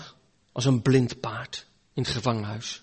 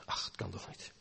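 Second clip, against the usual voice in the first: barely audible, all hope gone; despairing whisper; 9 semitones lower, 21 dB quieter than usual. Acted. Preacher voicing the sinner's despair in a whisper. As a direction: breathe it out as a hopeless whisper.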